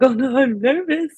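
playfully acted-out stage nerves; mock nervous. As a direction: imitate a nervous moment, lightly hammed-up. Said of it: "Instead of being like".

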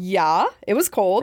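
put-on slow, drawn-out Midwest accent. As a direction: drawl it like a Midwesterner. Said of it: "Do you like my Midwest accent there?".

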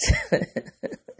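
squeaky laugh through tears; high teary laugh. Real, not acted. Embarrassed by crying, a high teary laugh. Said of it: "Ooh, I hate this".

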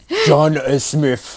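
put-on a half-hearted Austrian action-star accent; Arnold accent. Finishing the friend's joke line in a weak Austrian accent. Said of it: "I can't do an Arnold accent".

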